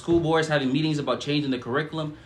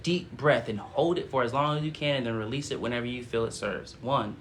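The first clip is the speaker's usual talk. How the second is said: slow, much softer, solemn meditation voice; guided prayer; 3 semitones lower, 6 dB quieter than usual. Acted. Streamer leads viewers in a calm channeled prayer. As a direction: guide them gently into breath.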